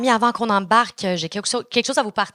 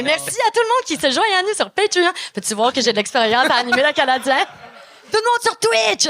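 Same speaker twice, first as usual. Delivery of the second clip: high, projected mock announcer thanks; crowd hype; 5 semitones higher than usual. Animator proving her experience to Patreon viewers, high hype. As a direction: hype up the viewers like an arena host.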